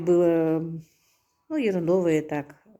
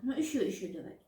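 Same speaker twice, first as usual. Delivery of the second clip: ordinary delivery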